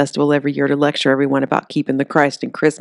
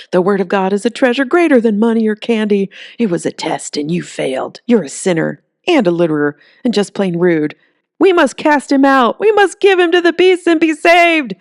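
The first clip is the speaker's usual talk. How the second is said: rising to a much higher, louder call; fanatical preacher; 6 semitones higher, 5 dB louder than usual. Acted. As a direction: whip the crowd into a frenzy. Zealot demands sacrifice.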